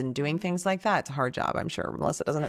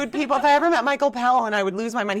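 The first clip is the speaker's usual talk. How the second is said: fangirl gush; 6 semitones higher, 5 dB louder, much faster than usual. Retold or imitated. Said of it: "I'm like".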